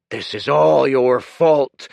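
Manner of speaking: loud, slow, snarling blame; snarled. Acted. Kuruk blaming Dorian, snarling. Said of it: "Kuruk snarled".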